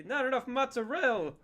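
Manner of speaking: high mock Italian accent on "mozzarella"; hammed-up Italian accent, sing "mozzarella". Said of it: "that part in G T A three".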